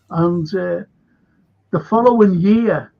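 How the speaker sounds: ordinary talk, nothing marked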